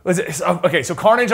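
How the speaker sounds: normal talking voice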